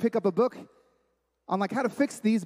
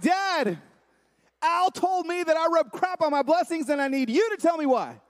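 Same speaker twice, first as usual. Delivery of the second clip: raised voice, pleading with dad; pleading son; 7 semitones higher than usual. Retold or imitated. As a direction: re-enact a high, urgent plea to your father. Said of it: "And I called my dad, and I'm like".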